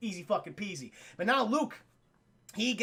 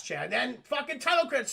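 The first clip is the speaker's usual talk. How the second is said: usual speaking voice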